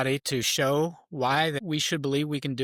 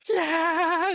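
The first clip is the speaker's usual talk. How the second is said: high, drawn-out cheer; retold ecstatic whoop; going nuts; 4 dB louder than usual. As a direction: cheer wildly for your kid, pitch way up. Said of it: "going nuts for him".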